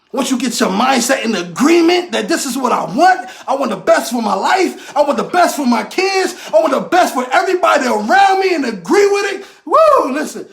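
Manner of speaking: fired-up motivational preaching; preaching at full force. Acted. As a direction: preach it hard and drive every question home. Preaching about wanting the best.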